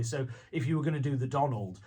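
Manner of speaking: ordinary talk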